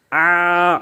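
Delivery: آ stretched for one alif length; long drawn aa. Shown as a demonstration. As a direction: hold آ out long. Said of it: "اتنا دراز کریں".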